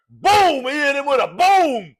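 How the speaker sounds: booming, excited football commentator; John Madden. Acted. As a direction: call the big hit loud and punchy. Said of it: "John Madden guys out there".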